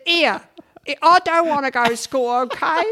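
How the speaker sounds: put-on stroppy Cockney child refusing school; childhood Cockney voice. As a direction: put on a Cockney kid's whine, loud. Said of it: "I said".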